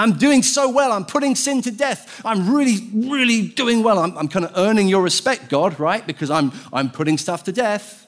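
high-pitched, boastful parody; mock self-congratulation. Believer boasting to God about killing sin, self-satisfied. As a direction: plead your own merits in a high mock voice.

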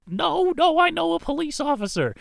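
high, loud whiny mock voice; a squeaky protest, hammed up; mock hypocrite. Corrupt official caught out, high loud whiny protest.

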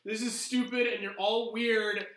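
high, loud tearful complaint; a fed-up NPC near tears; upset whining. Acted. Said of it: "she was like".

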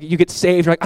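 usual speaking voice

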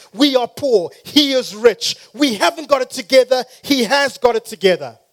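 loud, high, emphatic preacher's cry; full-force preaching. Real, not acted. Preacher rousing the congregation to believe, full-force preaching. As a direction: drive each phrase home at full volume.

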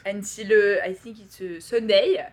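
hesitant French-accented English; halting French receptionist accent. Shown as a demonstration. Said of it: "my French accent, I hesitate".

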